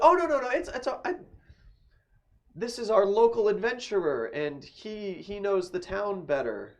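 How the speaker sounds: high, flustered brush-off; high-voiced lady fobbing him off.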